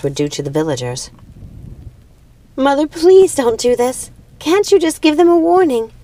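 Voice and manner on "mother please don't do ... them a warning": put-on high, pleading young girl's voice; begging. Primrose begging her mother to spare the villagers, pleading. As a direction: beg your mother to show mercy. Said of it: "begged Primrose".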